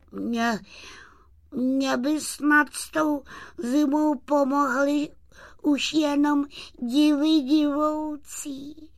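high, forlorn little sprite voice; helpless, high-voiced lament; sighing dejectedly. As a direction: sigh it out hopelessly, high-pitched.

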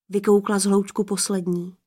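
plain reading voice

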